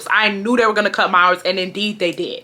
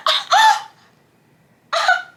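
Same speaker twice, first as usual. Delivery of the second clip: high gasping uh uh; shocked stammer. Retold or imitated. Shocked reaction.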